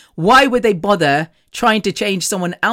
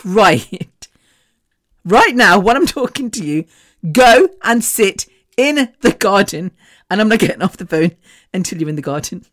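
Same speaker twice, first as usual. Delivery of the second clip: pissed off; 3 dB louder than usual. Retold or imitated. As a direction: lay down the law like an angry friend. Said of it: "so pissed off".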